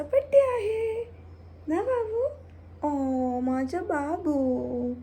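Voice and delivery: very high loving coo for the pet. Real, not acted. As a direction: gush over the pet's birthday. Cooing birthday to pet.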